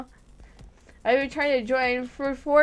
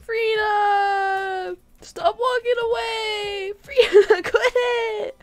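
high, drawn-out pleading call; whiny mock wail after someone leaving; 6 semitones higher, slower than usual. Player calls after Frina walking away, high whiny call.

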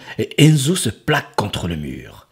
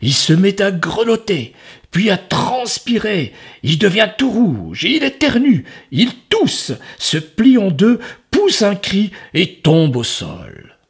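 building narration; 10 semitones higher, 4 dB louder than usual. A narrator's reading. Dramatic narration climax.

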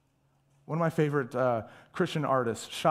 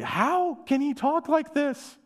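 astonished; 11 semitones higher, slower than usual. Retold or imitated. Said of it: "And they say".

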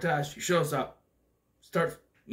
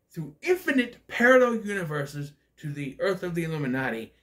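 ordinary talk, nothing marked

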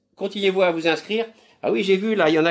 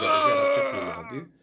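excited cry; 11 semitones higher than usual. Real, not acted.

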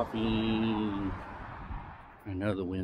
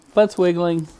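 pet talk; 9 semitones higher, 11 dB louder than usual. Real, not acted.

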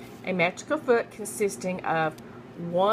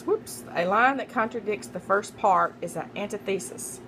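normal talking voice

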